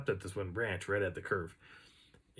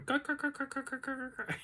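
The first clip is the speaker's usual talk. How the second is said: high-pitched rapid crow cawing; chattering crow caws; 5 dB quieter than usual. Retold or imitated. Teller imitates crows calling down from a branch, chattering caws. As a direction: imitate a crow's rattling run of caws. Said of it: "like, chattering".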